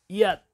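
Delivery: short brick-breaking yell; kiai shout. Shown as a demonstration. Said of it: "제 기합 소리를 들어보세요".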